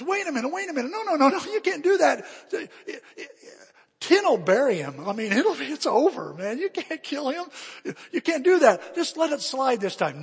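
high, loud pleading objections; frantic class protest. Acted. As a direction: protest in a panic as the whole class. Class protests Willie's punishment.

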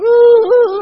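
loud, high mock wail; hammed-up child's howling; imitated crying. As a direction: bawl like a little boy. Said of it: "he's crying".

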